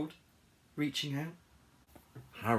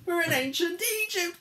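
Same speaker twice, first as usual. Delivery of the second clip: high, loud wail; alarmed cry; 5 dB louder than usual. Acted. Harold crash-lands in Egypt, high panicked cry. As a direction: cry out in dismay. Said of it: "cried Harold".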